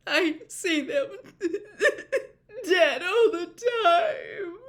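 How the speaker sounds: high, loud, slow crying with a sobbing laugh-break; crying moan. A narrator's reading. Missus Weasley moans her fears into Lupin's shoulder, crying. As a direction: wail it out between sobs. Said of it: "moaned into his shoulder".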